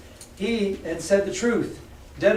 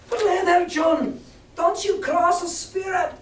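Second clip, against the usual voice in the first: much higher, slowed, panicky sailor; frightened pirate; 13 semitones higher, slower than usual. Acted. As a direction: plead in rising fear.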